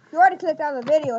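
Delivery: very fast, tumbling words; rushed aside. Real, not acted. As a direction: race through it.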